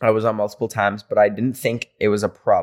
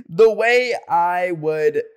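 dramatic emphasis; 7 semitones higher, much slower than usual. Real, not acted. Dramatic reaction, slow.

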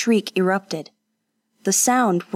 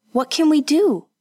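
desperate question; 8 semitones higher, 4 dB louder than usual. A narrator's reading. Frightened woman over dying girl.

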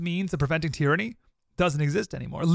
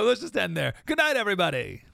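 higher-pitched joking farewell; breezy fake goodnight, pitched up; mock sign-off; 6 semitones higher than usual. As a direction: pretend to wrap up the show early. Jokingly ends show early in despair.

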